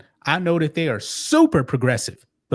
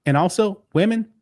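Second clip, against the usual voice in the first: usual speaking voice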